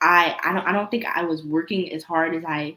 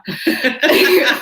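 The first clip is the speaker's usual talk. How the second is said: burst of laughter; 11 semitones higher, 9 dB louder than usual. Real, not acted. Proud laugh after big hit.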